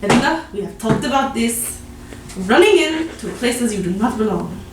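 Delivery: loud, exasperated telling-off; stern scolding. Acted. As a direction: scold a wayward charge loudly. Game master voices scolding of Edna.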